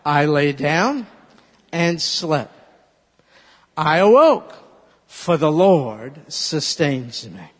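slow, loud on awoke; slow recitation. Shown as a demonstration. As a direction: lead the whole verse. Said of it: "Let's put it all together".

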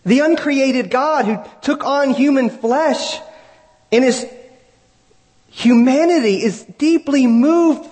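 high, slow, drawn-out preaching cry; impassioned preaching. Real, not acted. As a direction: preach it slowly, pitched high and swelling.

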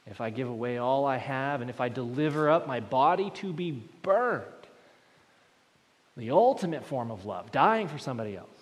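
loud, emphatic sermon delivery; a preacher hammering the point; preaching at full force. Acted. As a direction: drive every clause home. Preaching builds.

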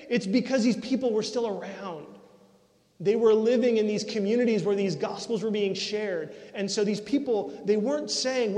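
full-force preaching. Acted. Pastor preaching to his congregation with full-force conviction. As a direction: preach it out to the whole room.

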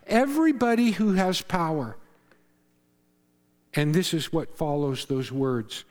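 ordinary delivery

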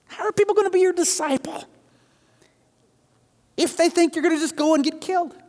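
high-pitched, incredulous remonstrating; acted-out agitated rebuke, voice pushed up high; exasperated protest. Peter rebuking Jesus, high exasperated protest.

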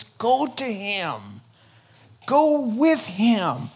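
very slow, drawn-out plea; slow urging. Acted. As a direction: stretch every word, pleading. Preacher pleading, emphatic urging.

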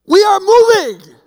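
loud, high and slow, "We are moving" pressed out; husband laying down the law to his wife, loud and firm; firm loud declaration. Retold or imitated. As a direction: put your foot down. Husband announcing a decision.

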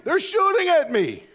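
surprised soldier. Retold or imitated.

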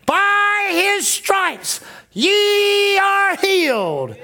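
very slow, high, loud proclamation; spirit's voice shouting the promise over the fear; triumphant shout. Retold or imitated. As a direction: boom the scripture out as a victory cry. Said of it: "the voice in her spirit was saying".

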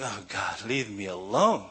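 mild put-upon grumble, lifting on the last word; exasperated plea. Retold or imitated. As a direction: plead wearily to be left alone, lightly acted. Retold dread at man arriving.

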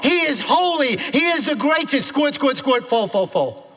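high, loud declamation in driving bursts; full-force preaching. Real, not acted. Preacher declaring who God is to the congregation, full-force preaching. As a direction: proclaim each title of God at full pitch.

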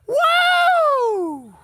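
a high, loud whoop of delight; whooping shout. Real, not acted. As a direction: let out a preacher's whoop. Preacher whoops after a point.

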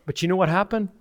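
usual speaking voice

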